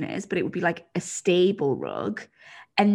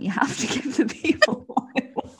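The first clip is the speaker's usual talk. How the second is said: breaking into laughter mid-sentence; laughing insistence; 4 semitones higher than usual. Real, not acted. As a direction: egg them on through laughter. Laughs while egging co-host on.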